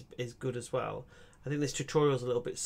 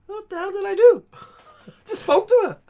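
much higher and louder, laughing; laughing shout; 14 dB louder than usual. Real, not acted. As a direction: laugh out the disbelief at the chaos. Laughing at accidentally burning tree.

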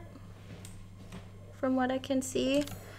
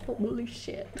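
usual speaking voice

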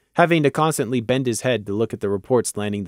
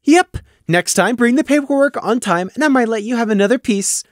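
high, louder mock sternness; mock seriousness; 10 semitones higher, 3 dB louder than usual. Taffy scolds Gloom, high and mock stern. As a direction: pretend to scold. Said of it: "with mock seriousness".

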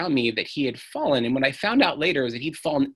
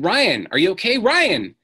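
loud, high, urgent calling of a name; frantic calling; 6 semitones higher, 7 dB louder than usual. Retold or imitated. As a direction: call out to a son lying still, panicking. Father calling his injured son.

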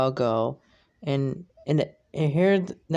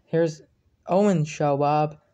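usual speaking voice

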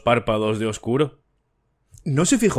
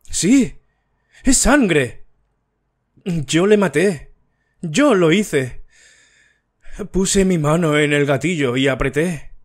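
higher, climbing panic to a pleading cry; hysterical confession; 5 semitones higher, slower than usual. A narrator's reading. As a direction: beg to be saved as the ghost returns. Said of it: "Su voz se apagó en un sollozo".

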